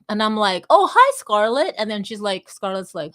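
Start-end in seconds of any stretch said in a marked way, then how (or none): 0.7-1.8 excited greeting. Retold or imitated. Calling out to Scarlett passing by.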